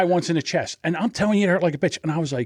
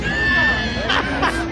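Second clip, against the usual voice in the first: a real high shriek of laughter; a shocked, shrill laugh. Real, not acted. Shocked by wheelchair in clip.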